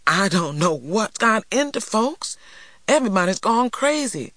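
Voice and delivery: high-pitched, uneasy brush-off. A narrator's reading. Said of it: "he laughed uneasily".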